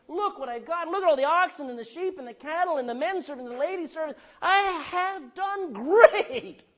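high, loud and showy, slowing on the end; boastful bragging. Acted. Preacher voicing Jacob bragging to his brother, high and loud. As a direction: brag like a proud rich man.

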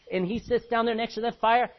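usual speaking voice

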